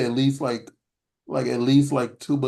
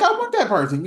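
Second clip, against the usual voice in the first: excited wanting; 10 semitones higher, 3 dB louder than usual. Retold or imitated. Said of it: "It's like".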